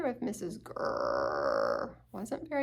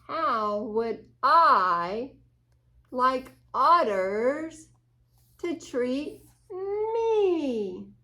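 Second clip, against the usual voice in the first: drawn-out thoughtful question; slow pondering; 3 semitones higher, 10 dB louder, much slower than usual. Acted. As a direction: stretch each word while thinking. Rabbit ponders slowly.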